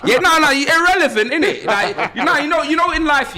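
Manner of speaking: high, laughing put-down, cracking up mid-taunt; laughing mockery. Podcaster mocking a viewer, high and laughing. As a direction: laugh at him while calling him irrelevant.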